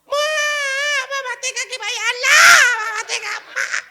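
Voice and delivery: very high, loud fake sobbing cry; mock crying child. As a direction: wail like a child for grandpa. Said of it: "beri contoh".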